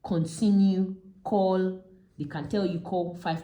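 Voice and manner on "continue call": louder radio-style instruction from the tower; controller instruction. Retold or imitated. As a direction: call it out like the tower over the radio. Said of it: "they say".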